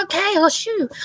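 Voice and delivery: high-pitched retold reply; mock eager reply. Sister answering her brother's driving lesson, high-pitched mock eagerness. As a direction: pipe up high, acting out your younger self.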